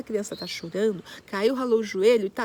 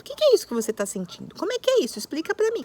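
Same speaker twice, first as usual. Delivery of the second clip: caring parent; 8 semitones higher, 3 dB louder, faster than usual. Shown as a demonstration. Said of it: "identificar junto com a criança".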